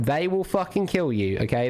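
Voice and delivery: ordinary talk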